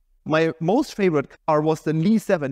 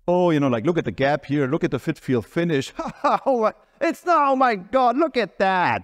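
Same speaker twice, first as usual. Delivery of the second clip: mocking. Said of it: "they were making fun of it".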